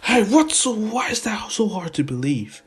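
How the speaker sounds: high, loud, incredulous; shocked disbelief. Retold or imitated. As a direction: squeal in disbelief. Midoriya shocked Kacchan helped.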